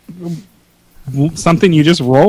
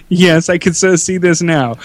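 ordinary talk

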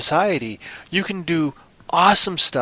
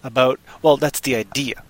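ordinary talk, nothing marked

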